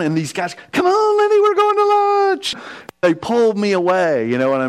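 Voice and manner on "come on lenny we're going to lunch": much higher, louder hammed-up buddy voice; mock buddies calling. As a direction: squeal it like pushy friends dragging him off. Friends pull him to lunch.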